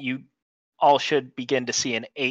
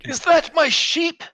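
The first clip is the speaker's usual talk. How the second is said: put-on high, loud, slow old man voice; indignant old man; 4 dB louder than usual. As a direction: demand it like a shocked old man. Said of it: "And then he spots the sheep".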